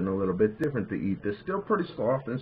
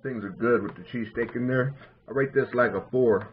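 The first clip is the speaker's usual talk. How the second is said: talking while chewing; mouth full. Real, not acted. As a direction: food in mouth. Seen 0: egg roll held at mouth after bite; bites again at end.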